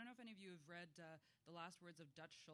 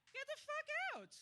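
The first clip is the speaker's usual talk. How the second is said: surprised; 14 dB louder than usual. Real, not acted. Audience reveal about Le Guin.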